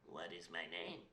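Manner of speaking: low, soft questioning imp; lightly voiced imp. Imp quizzing the princess at midnight, low and soft. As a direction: pose the riddle low and soft. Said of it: "and he asks her".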